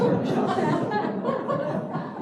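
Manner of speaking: high, loud burst of laughter; real laugh. Real, not acted. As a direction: laugh out at the joke. Laughs at own joke.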